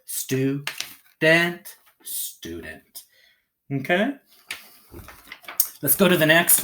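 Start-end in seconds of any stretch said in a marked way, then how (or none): 0.1-1.8 "Stu, dent" in two separated beats; syllable by syllable. Shown as a demonstration. Teacher putting syllables together, "Stu, dent" said apart. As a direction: break "Stu, dent" apart, each syllable stressed, second higher. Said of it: "Put it together".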